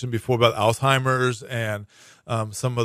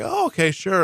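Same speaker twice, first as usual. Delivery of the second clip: higher-pitched, imitated easy compliance; breezy agreement; 7 semitones higher than usual. Scam victim to caller, agreeing to help, breezy imitation. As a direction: go along innocently, suspecting nothing.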